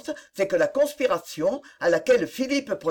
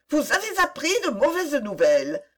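mild exclamation; 7 semitones higher than usual. A narrator's reading. Anxious young woman to the man back from London, exclaiming. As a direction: exclaim it in a raised pitch. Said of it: "s'écria-t-elle".

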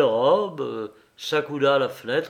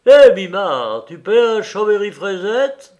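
loud, high-pitched hail to a mate; calling out; 6 semitones higher, 10 dB louder than usual. A narrator's reading. Pluche asks Mimard to buy a drink, calling out. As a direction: call out boldly, rising on the question. Calling to a card partner in a bar.

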